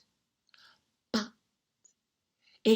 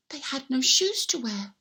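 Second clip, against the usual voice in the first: plain reading voice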